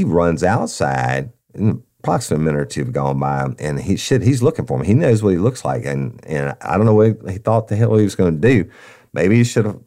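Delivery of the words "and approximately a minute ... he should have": ordinary delivery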